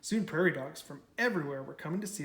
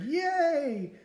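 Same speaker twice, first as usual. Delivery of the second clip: higher, bright cheer; 11 semitones higher, 4 dB louder than usual. Acted. The crowd cheering Big Bark's fall, higher cheer. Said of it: "the crowd cheered".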